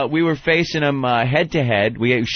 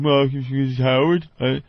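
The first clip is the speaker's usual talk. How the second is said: slow, dopey answer. Retold or imitated. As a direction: answer like it's obvious. Imagined dumb answer.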